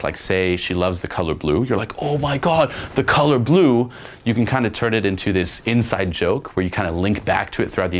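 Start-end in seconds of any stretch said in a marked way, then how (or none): 2.0-3.9 high-pitched mock awe; hammed-up thrilled exclamation; overblown amazement. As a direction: gush over something utterly basic. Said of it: "when you overblow something very basic".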